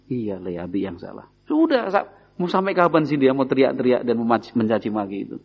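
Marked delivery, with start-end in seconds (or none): 0.1-1.3 soft, low, gentle admission of fault; a father's soft apology to his son; sincere apology. Retold or imitated. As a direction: say it quietly, giving in sincerely.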